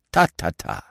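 brisk impatient tutting; mild impatient tut-tut; impatiently. Acted. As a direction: brush the idea aside. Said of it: "said Poirot impatiently".

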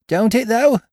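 high-pitched, relieved delight; eager young pirate squeal; joyful cry. A narrator's reading. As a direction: pipe up with sudden hope. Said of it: "cried Dick with a sort of joy".

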